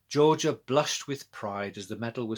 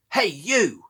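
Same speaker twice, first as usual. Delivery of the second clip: loud sharp call across the churchyard; shout; 7 semitones higher, 8 dB louder than usual. A narrator's reading. Girl shouting at a purse thief, loud call. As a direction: yell to stop him. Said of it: "shouted Georgia".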